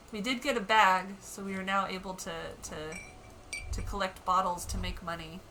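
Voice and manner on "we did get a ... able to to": ordinary talk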